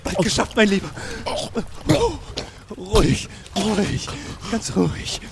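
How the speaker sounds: calm him gently; soothing. Acted. Calming dying man.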